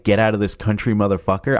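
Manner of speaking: normal talking voice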